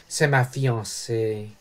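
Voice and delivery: low, soft and slow, weighing each word; a young sailor's grave, earnest correction. A narrator's reading. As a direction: say it solemnly, quietly and slowly. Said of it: "dit gravement le jeune marin".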